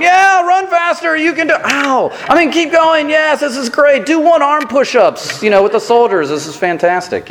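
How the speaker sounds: mock cheerleading. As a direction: cheer the runners like an injured fan, overdone. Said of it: "the guy with a sling going".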